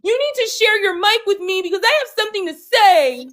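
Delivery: put-on high whiny toddler voice; whiny man child. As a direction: whine like a child denied a turn. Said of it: "Now he whining like a toddler".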